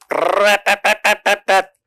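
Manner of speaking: rattling, high trumpet noise; imitated trumpet blast. Angels blow trumpets on God's command, imitated blast. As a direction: mimic a trumpet in a rapid rolled stutter.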